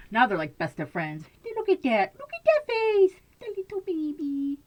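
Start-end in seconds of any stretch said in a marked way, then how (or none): 1.6-4.6 high, cooing pet voice. Real, not acted. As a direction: gush over a tiny kitten. Baby talk to the kitten.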